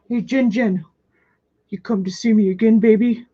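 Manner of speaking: jokingly put-on boyfriend voice; playful boyfriend voice. Streamer pretends to be viewer's boyfriend, playful put-on voice. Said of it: "That was Jen Jen's boyfriend".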